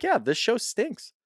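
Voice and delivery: high, slow and blunt; blunt honesty. Pretend. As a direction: say it plainly, no excuses. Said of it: "be like".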